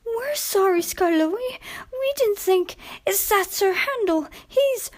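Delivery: high, small, apologetic coach voices; sorry coaches. Acted. Coaches apologising to stern old Skarloey, high and sheepish. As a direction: plead like naughty little coaches.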